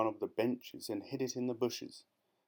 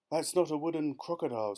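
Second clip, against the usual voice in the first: higher, lightly voiced bird; 6 semitones higher, faster than usual. Roly-poly bird tells Jill. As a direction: chirp it out as the bird.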